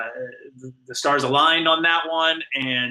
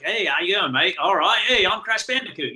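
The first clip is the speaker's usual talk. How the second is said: playful, higher-pitched Australian greeting; Australian accent; 5 semitones higher than usual. Acted. Actor larking about as Crash in the booth, Australian accent. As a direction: put on a larky Australian accent. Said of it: "this Australian accent in my ear".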